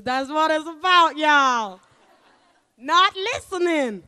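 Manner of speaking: loud, high, drawn-out holler to the crowd; playful holler. Real, not acted. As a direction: belt it out slowly at the audience.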